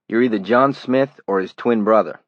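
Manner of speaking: put-on a city stranger greets Johnny, loud Brooklyn accent; flat Brooklyn accent. A narrator's reading. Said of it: "He had a flat city accent as well".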